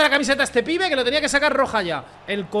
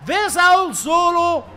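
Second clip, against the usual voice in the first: slow, higher announcer voice in part Portuguese; a lightly voiced Brazilian commentator; mock hype announcer; 6 semitones higher, 3 dB louder, slower than usual. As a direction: hype him up like a TV promo.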